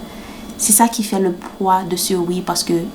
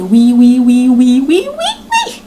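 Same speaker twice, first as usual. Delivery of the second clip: yeses climbing to a high squeal; mock excited yeses; 4 semitones higher, 6 dB louder than usual. Mocking wedding-season vows, escalating giddy squeal. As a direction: build louder, then soar way up in pitch.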